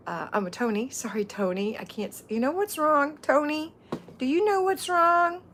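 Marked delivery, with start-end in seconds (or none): 2.3-5.4 high, loud playful rant; mock exasperation. Real, not acted. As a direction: build up a comic complaint, pitched high. Mock-dramatic question about her glasses.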